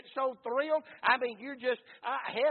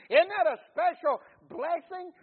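higher and louder, full of wonder; rising preacher's exclamation; 6 semitones higher, 10 dB louder than usual. Acted. Preacher crowns a run of questions, loud and high. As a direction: lift the last one high and loud.

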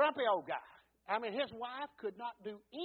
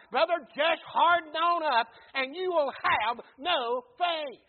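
louder, higher, driving revival preaching; preaching at full force; 5 semitones higher, 12 dB louder than usual. Acted. Pastor warning his congregation against hardened hearts, full-force preaching. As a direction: hammer each line home to the congregation.

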